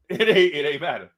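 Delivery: ordinary talk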